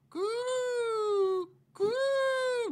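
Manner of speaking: high, slow bird-like screech; pteranodon cry. Pretend. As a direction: stretch it out like a flying reptile's call. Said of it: "どんな感じだっけ？".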